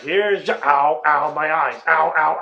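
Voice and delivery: mock pained Jack. Jack stabbed in the eyes by Wendy, hammed-up pained yelps. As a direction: cut off mid-catchphrase by the pain.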